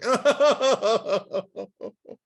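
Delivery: high, loud burst of laughter; hysterical laugh. Retold or imitated. Friend showing how she laughed at the movie, hysterical laugh. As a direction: cackle helplessly. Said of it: "I was laughing hysterically".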